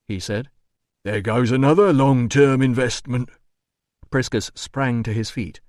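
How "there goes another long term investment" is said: loud, mocking doorman voice; jeering doorman. A narrator's reading. Doorman taunting the administrator, loud and mocking. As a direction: gloat loudly over the damage. Doorman jeers after kicking table.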